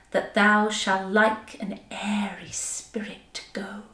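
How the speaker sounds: commanding Shakespearean declamation, building then softening; Titania speaking to Bottom. Acted. Titania begging Bottom to stay, dramatic verse. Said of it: "this is her saying to Bottom".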